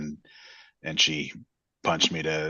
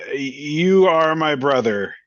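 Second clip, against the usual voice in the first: put-on high, slow drunken slur, louder; drunk slurring; 9 semitones higher, 7 dB louder, slower than usual. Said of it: "unsteady on her feet and slurring her words pretty badly".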